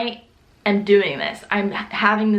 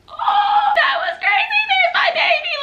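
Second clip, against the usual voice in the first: amazed shout; slower than usual. Retold or imitated. Mom touching baby's head, shouting with joy. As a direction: cry out in amazement. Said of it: "I was like".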